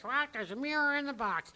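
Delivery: high-pitched parrot squawk. Acted. Captain's parrot exposing the box trick, high squawk. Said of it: "and the parrot says".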